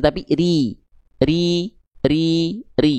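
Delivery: ri said thin and light. Shown as a demonstration. Teacher demonstrating the correct thin ro. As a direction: say ri thin with the tongue middle up.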